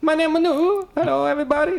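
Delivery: a cartoonish French chef introducing himself; French accent. Mocking the chef Manu's French accent to a friend, high and loud. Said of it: "that's not my French accent".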